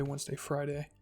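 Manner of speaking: plain reading voice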